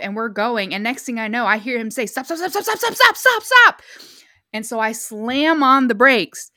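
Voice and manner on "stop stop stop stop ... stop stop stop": panicked stop getting higher and louder; building alarm, from tense to a yell; rising panicked yell. Retold or imitated. Friend yelling at the gate.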